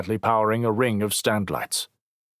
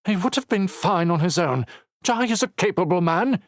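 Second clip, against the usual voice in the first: flustered stutter; 11 semitones higher than usual. Acted. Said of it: "Dossett stuttered".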